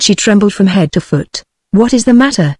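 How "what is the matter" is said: ordinary delivery, nothing marked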